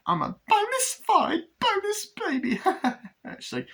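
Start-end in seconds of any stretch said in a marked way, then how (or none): 0.1-2.6 very high, squeaky put-on voice; manic boast. Glenda's manic boast, acted. 2.6-3.3 high-pitched imitated giggle; manic giggle. Glenda giggles after boast.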